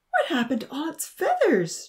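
high, horrified outcry; horrified cry. A narrator's reading. Charlie seeing the plucked chicken, horrified. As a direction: cry out in horror, pitch soaring. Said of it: "Charlie cried out, horrified".

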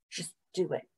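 hushed, urgent aside; barely-there murmur; whisper. A narrator's reading. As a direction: whisper it very softly to a friend. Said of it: "whispered Somkit".

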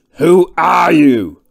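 slow, very loud bellow; big, drawn-out angry roar; bawling. A narrator's reading. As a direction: bawl each word at the intruder. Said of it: "bawled the thick man".

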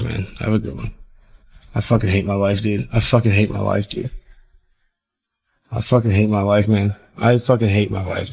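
low, quiet and defeated; deflated after losing it all; dejected. Real, not acted.

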